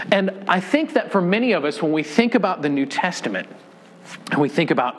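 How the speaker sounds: normal talking voice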